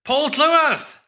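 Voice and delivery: loud, high, stretched name; mock announcer shout. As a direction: belt out your own name like a ring announcer. Host hands over for the name.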